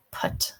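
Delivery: "put" softly, vowel from down in the throat; careful, quiet "put"; deep horseshoe vowel. Shown as a demonstration. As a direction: model "put" with its short back vowel. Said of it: "from down in your throat".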